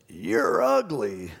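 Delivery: drawn-out squawky parrot; slow, cheeky talking-bird voice. Retold or imitated. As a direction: stretch it out like a parrot taunting. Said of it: "and the parrot goes".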